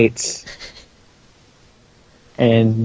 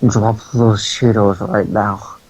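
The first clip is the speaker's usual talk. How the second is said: mock yell; 3 semitones lower, slower than usual. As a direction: play at yelling a slow, deep command. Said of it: "and he just yells".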